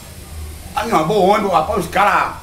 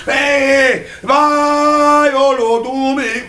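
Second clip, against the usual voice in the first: imitating rowdy guys yelling, pitched up and louder; mock shouting; 6 semitones higher, 4 dB louder, much slower than usual. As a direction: holler like the troublemakers on the bus. (imitando os caras gritando)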